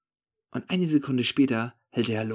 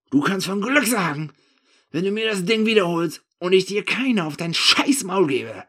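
loud, high angry threat; threatening; 8 semitones higher, 7 dB louder than usual. A narrator's reading. Narrator warning the thief of a beating, threatening. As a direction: threaten him at full voice. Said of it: "drohe ich ihm".

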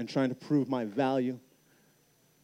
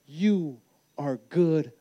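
slow preaching emphasis; much slower than usual. Acted. Sermon climax, slow appeal.

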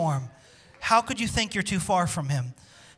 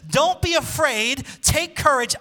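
voicing God, proclaimed; 6 semitones higher, 4 dB louder, slower than usual. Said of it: "He says".

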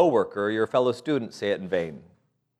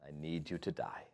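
quiet answer; 6 semitones lower, 18 dB quieter than usual. Shown as a demonstration. Softly giving the proper answer to Jesus. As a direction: hush it like a solemn confession. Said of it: "is the proper answer".

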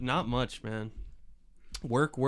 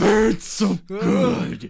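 slow mock pleasure; 6 semitones higher, 7 dB louder, much slower than usual. Imitating pleasure in hot coffee.

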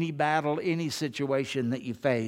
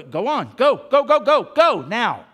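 shouted, much higher and louder; urgent go shout; 8 semitones higher, 12 dB louder than usual. Retold or imitated. Preacher voicing God pushing jumpers out, urgent shout. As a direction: yell them out the door, go go go.

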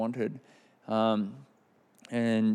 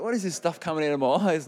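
laughing disbelief; 6 semitones higher, 4 dB louder than usual. Retold or imitated. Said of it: "You know, like".

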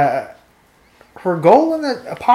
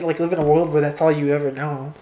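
ordinary delivery

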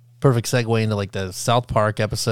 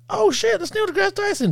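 excited. Retold or imitated. Spotting a celebrity in the street.

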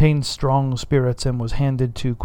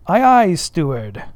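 Aye, aye, steward called out louder; cried out; 4 semitones higher, 6 dB louder than usual. Acted. Stubb cries out at the steward, louder. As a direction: cry it at the steward. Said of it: "cried Stubb".

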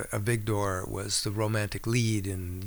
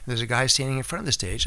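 normal talking voice

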